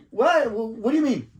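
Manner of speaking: high, loud flustered "What?"; startled outburst. Retold or imitated. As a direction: caught off guard, voice jumping up. Cop reacts to the compliment again.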